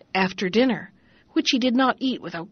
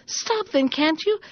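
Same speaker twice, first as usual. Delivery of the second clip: calling out; 7 semitones higher than usual. Acted. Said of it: "called out between his hops".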